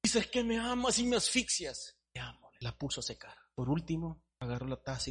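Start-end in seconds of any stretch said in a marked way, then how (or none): 0.0-2.0 high, choked teacup voice; suffocating teacup. Acted. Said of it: "se asfixiaba la tacita". 2.1-2.5 softer, tender potter; quietly tender potter; a touch of a tender potter. Acted. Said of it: "potter answers softly".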